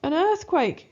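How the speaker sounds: high, loud scared cry; shrieking rat in terror; frightened shout. Acted. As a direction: scream in panic at the shaking. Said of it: "shouts Rose".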